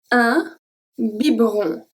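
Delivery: Un biberon, middle E dropped as bibron; model pronunciation. Shown as a demonstration. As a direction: say biberon with the E silent, bib-ron. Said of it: "So I don't say".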